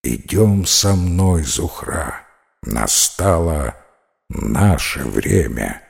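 low, slow and ominous; deep serpent voice. Acted. Serpent claims his bride.

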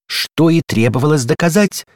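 exclaimed in triumph; triumphant exclamation. A narrator's reading. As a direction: crow as if proving a point. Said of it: "воскликнула дверная ручка".

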